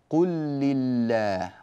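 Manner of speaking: slow demo of قل لله; merged double lam. Shown as a demonstration. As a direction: stretch قل لله into one held lam. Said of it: "اللام الأولى أدغمت في اللام الثانية".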